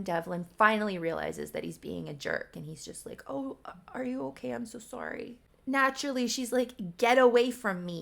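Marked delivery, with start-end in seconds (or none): 3.3-5.4 softer, higher voice of mock concern; retold apology. Brother to crying sister after being a jerk, soft apologetic imitation. 7.0-8.0 louder, higher mock outburst; retold angry rebuff. Crying sister to the brother who upset her, loud mock rebuff.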